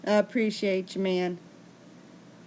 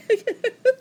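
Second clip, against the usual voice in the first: embarrassed laugh; 5 dB louder than usual. Real, not acted. Laughing at own story.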